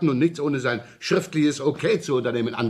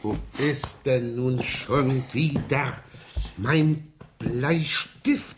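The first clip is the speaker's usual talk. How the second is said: drawn-out searching mumble; slowly wondering aloud where it went; slow puzzled mutter; much slower than usual. Acted. Inspector searching his desk, slow and puzzled.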